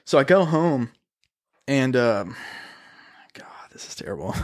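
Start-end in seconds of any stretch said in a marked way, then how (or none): 3.3-4.3 breathless whisper, much softer, fast; whispered aside. Real, not acted. Comedian whispers to the hosts, cringing at his own story, whispering. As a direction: mutter it under your breath, half ashamed.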